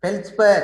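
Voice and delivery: feldspar said high and loud, stretched out for the class; teacher's model word. Shown as a demonstration. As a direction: call out feldspar for the students to repeat.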